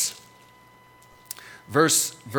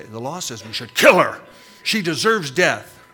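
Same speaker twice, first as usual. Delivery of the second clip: ordinary talk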